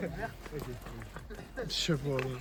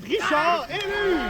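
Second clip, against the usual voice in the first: excited; 12 semitones higher than usual. Real, not acted. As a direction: draw out the goal call. Goal called.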